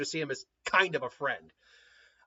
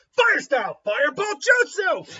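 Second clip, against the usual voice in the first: jutsu battle cry; 11 semitones higher, 8 dB louder than usual. Acted. Sasuke casting jutsu in fight.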